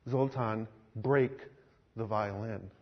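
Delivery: low, quiet, slow; soft firm command. Acted. Jesus softly repeats his command to Zoltan. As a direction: lay it down gently but firmly.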